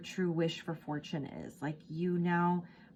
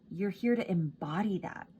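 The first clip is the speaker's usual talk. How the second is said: ordinary talk, nothing marked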